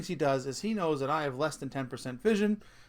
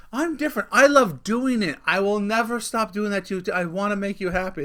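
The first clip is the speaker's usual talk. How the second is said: higher, louder mock devotion; imitating wife; 4 semitones higher, 6 dB louder than usual. Husband quoting his wife's promises, mock-earnest imitation. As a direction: mimic a wife's eager promises, pitched up. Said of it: "My wife said this one".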